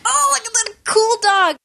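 high-pitched gushing over a dog; mock delight. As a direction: squeal it like an excited dog lover. Acting out delight at seeing dog.

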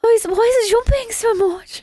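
put-on high-pitched British kid voice; British little child. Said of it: "so it's double British accent".

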